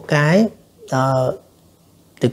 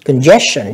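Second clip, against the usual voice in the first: Congestion with the middle syllable stressed; stress on second syllable; 2 semitones lower, much slower than usual. Shown as a demonstration. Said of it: "nhấn âm thứ hai".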